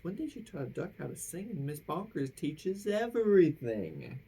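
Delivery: ordinary delivery, nothing marked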